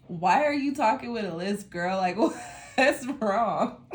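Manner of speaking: laughing at her own lisp; giggling through the question. Retold or imitated. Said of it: "it makes me laugh because it's like".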